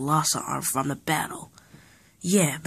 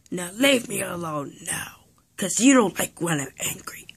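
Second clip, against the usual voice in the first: normal talking voice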